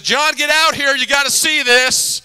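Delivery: urgent call from outside. Retold or imitated. Wife calling him outside.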